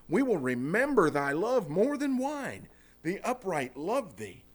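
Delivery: measured, solemn scripture reading, slowing at the end. Acted. Preacher reading the verse to the church, reverent and measured. Reads Song of Solomon passage.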